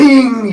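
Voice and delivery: sharp high ting of metal, mimicking the ring; imitated ting. Retelling hitting the ropes, a loud high mock ting. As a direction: ring it out like metal struck hard. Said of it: "You could hear the ting".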